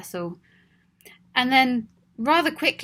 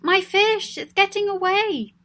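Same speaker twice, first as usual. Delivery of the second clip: much higher, louder alarmed cry; child crying out; 10 semitones higher, 5 dB louder than usual. Acted. Child watching the fish slip away, crying out higher and louder. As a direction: call out as the fish escapes. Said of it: "heard the child cry out".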